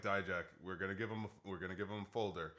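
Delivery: whisper-plan it quickly to your partner; hushed scheming. Retold or imitated. Scheming quietly with Dijak.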